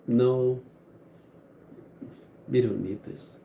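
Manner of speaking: ordinary delivery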